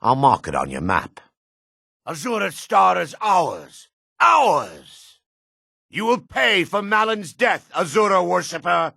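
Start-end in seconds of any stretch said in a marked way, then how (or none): 2.1-8.9 high, strained fanatic yelling; zealot's furious threat. Acted. Cultist avenging Malin threatens the Azura worshipper, high furious yell. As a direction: shriek out a zealot's vengeful threat.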